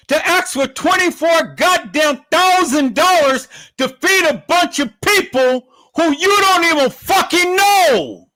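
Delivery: shouting at the mother in disbelief; full furious yell; angry shout. Real, not acted. Enraged rant at the mother.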